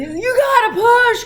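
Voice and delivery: loud high yell of a doctor. Retold or imitated. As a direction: shout it like a doctor urging push. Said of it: "the doctor's yelling at us".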